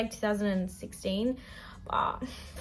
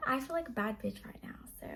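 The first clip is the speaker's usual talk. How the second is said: soft unvoiced whisper; 3 semitones higher, 8 dB quieter, faster than usual. Real, not acted. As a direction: whisper it with sass. Whispered confident aside.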